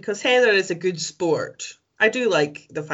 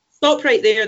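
louder mock dramatic stop; 4 semitones higher, 6 dB louder than usual. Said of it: "the poem said".

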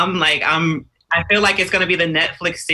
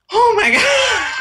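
much higher shocked cry, slow; 5 dB louder, slower than usual. Retold or imitated. Viewer retells watching a death, high slow shocked cry. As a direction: cry it out as if a favorite died. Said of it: "I was like".

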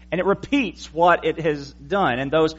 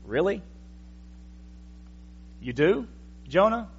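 skeptical question; 4 semitones higher, 6 dB quieter than usual. Acted. Preacher doubts Jonah's claim of fear.

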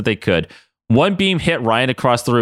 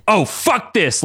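cried out; 9 semitones higher, much slower than usual. Acted. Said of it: "Logan cried out".

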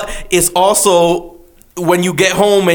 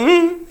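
pinched wince noise; 11 semitones higher than usual. Retold or imitated. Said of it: "Do that noise again".

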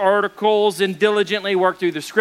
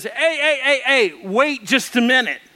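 higher-pitched acted objection; indignant protest; 4 semitones higher than usual. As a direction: cut in, mock-affronted, pitch raised. Pastor protests to Saint Peter.

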